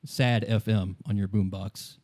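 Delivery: ordinary delivery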